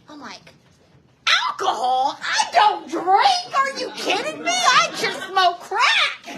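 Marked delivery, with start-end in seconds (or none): 1.5-6.3 loud, drawn-out mock affront; comic indignation. Answering detox worker about alcohol, indignant. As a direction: protest high and loud, stretching key words.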